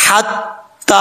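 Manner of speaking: हत्ता with a light, thin ha from the throat; Arabic ha demo. Shown as a demonstration. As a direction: recite हत्ता carefully, thin ha. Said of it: "यहाँ पर हा बरीक है".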